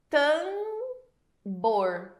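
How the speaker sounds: "Tambor" with the A stretched and nasal, no separate M; nasal vowel demo. Shown as a demonstration. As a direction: hold the nasal "Tambor" long. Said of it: "Você percebeu?". ("Tambor" com vogal nasal prolongada)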